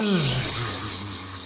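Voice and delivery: long, low tired sigh; weary sigh. Acted. Wizard sighs at the lawn news. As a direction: sigh like the talk is a chore. Abacus dreading the lawn talk.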